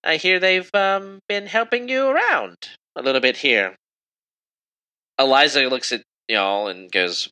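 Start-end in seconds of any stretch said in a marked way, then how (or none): 0.0-3.7 put-on high regal old lady; pitched-up royal voice with measured hesitations; elderly queen, inquisitive. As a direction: probe politely in a high queen voice.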